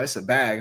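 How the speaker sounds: "bag" with a short flat a. Shown as a demonstration. Teasing a friend's Midwest big, short a. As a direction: say "bag" short, not baig. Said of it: "It's a short A".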